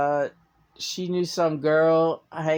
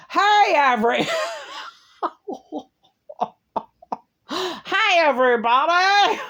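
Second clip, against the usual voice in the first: much higher, louder mocking Caroline voice with laughter; imitating Caroline; 13 semitones higher, 4 dB louder than usual. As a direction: lampoon her chirpy hello through laughter. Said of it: "this is what she sounds like".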